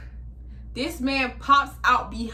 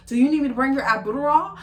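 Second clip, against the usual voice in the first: fast, louder mocking read of his message; mock stalker text; 6 semitones higher, 6 dB louder, faster than usual. Ex's harassing message, mocked fast and loud. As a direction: parrot his clingy message, a bit louder.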